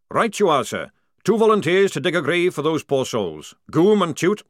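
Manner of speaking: loud barking drill sergeant; booming sergeant. Acted. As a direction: call the lads to order. Jackram voiced.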